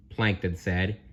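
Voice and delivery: ordinary delivery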